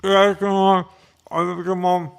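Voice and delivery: talking while chewing; words through a mouthful of food; mouth full. Real, not acted. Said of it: "chew with your mouth full".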